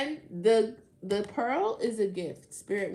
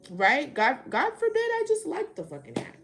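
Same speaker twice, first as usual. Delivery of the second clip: loud, high-pitched sarcastic outburst; sarcastic indignation; 7 semitones higher, 9 dB louder than usual. Real, not acted. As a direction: protest loudly with biting sarcasm. Sarcastic protest at judgement.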